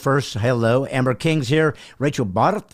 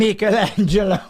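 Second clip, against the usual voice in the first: high mock Italian accent, laughing; comic Italian name-drop, pitched up; 5 semitones higher, 4 dB louder than usual. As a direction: ham up the Italian, breaking into laughter. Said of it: "in an Italian accent".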